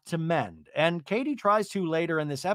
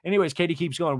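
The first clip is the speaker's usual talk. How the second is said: usual speaking voice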